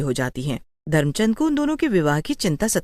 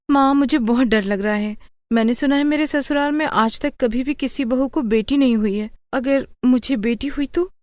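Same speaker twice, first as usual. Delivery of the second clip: fearful daughter; 6 semitones higher than usual. Acted. Pregnant daughter voices her fear.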